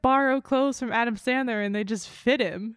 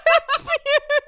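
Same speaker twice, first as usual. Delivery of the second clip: high, breathless laugh-crying talk; laughing hard; 4 dB louder than usual. Real, not acted. [name] laughing so hard she can barely speak. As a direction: try to talk through helpless laughter. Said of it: "The crying sound of you in the distance".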